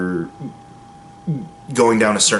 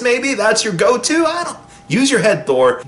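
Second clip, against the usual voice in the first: pitched way up in mock disbelief; incredulous sarcasm, much higher; sarcastic jab; 10 semitones higher than usual. Real, not acted. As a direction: needle him like he missed the obvious. Mocking Thor's poor search for Odin.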